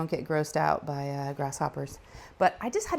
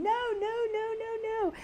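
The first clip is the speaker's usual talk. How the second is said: very high, softer imitated shriek of no; daughter screaming no; 3 dB quieter than usual. Daughter protesting letting the dogs eat the bug, high mock shriek. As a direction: imitate a girl squealing in protest. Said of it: "Lou starts screaming".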